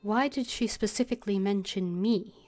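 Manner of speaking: ordinary talk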